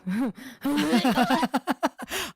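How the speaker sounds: high cracking-up laughter; long helpless laugh. Real, not acted. Streamer cracking up at the video, helpless laughter. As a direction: burst out laughing and keep going.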